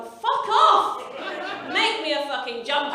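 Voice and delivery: loud, explosive comic fury; outraged shout. Acted. Comedian rejecting friends' crochet gift, outraged shout. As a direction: burst out high, then indignant.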